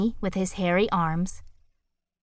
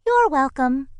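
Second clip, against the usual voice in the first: perky bird voice; 7 semitones higher, 5 dB louder than usual. A narrator's reading. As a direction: chirp it out politely. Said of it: "said Kalinka".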